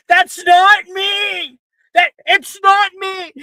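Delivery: high, loud, slow protest; panicked shout. Acted. Rin in line denying the fake Rin, panicked shout.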